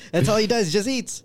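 laughing while talking. Real, not acted. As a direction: talk through the laugh. Laughing at own joke.

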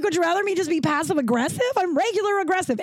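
high-pitched, mock-outraged retort; retold indignant comeback. Answering a friend who finds her scary, indignant. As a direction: fire back with the pitch well up.

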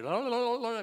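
loud high ululating la la la; a loud mock mourning wail; imitated wailing. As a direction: mimic mourners keening at a funeral. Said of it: "paid mourners that stand outside and wail".

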